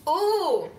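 high, loud teasing ooh; a delighted, flustered coo; flattered ooh. Real, not acted. Flattered after being called pretty.

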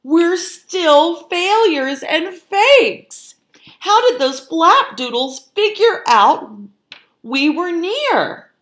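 high, loud, drawn-out fretful wail; fretting. A narrator's reading. Fox leader lamenting their failures to the cook, loud fretting. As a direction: wail in frustration. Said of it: "fretted Frank".

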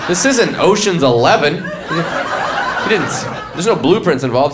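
ordinary talk

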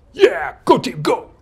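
very high, loud cheer for the team. Pretend. Teacher acting out the cheer verb, high excited whoop. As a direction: whoop it up like a fan.

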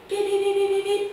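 high, loud ringing beeps; a loud high alarm beeping from headquarters. Acted. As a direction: ring like the radio call. Headquarters calling signal.

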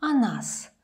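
"О нас" with о sounding like a. Shown as a demonstration. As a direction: open a for the о in "О нас". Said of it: "it sounds more like a".

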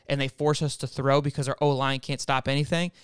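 usual speaking voice

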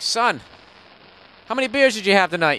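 high, loud, quick mock-dad questioning; imitated dad. Dad asks son about his beers, mock interrogation. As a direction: put on an incredulous dad grilling his kid. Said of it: "your fucking dad would laugh at you".

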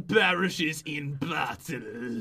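hammed-up grave pronouncement, very drawn out; mock-dramatic announcement. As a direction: stretch out the doom for effect. Dramatic announcement of game rules.

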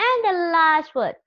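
normal talking voice

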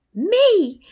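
high, loud shocked "Me?"; startled squeak. A narrator's reading. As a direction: jump at the word in alarm. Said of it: "said Martha".

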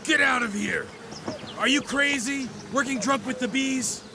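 high-pitched angry yelling; furious outburst. Acted. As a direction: throw him out in a rage. Boss throws out drunk worker.

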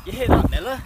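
normal talking voice

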